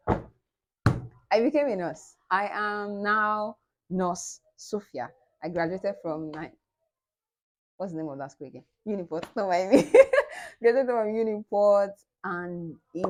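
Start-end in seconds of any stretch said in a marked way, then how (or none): 0.1-5.1 slow proud declaration; slow triumphant nurse announcement. Real, not acted. 10.0-10.6 high loud burst of laughter; sudden loud squeal of laughter. Real, not acted. Laugh after forgetting school name.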